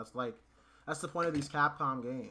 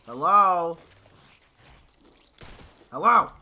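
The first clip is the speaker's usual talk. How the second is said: loud, high call; 7 semitones higher, 16 dB louder, slower than usual. Real, not acted. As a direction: yell it across the room. Calling out to character.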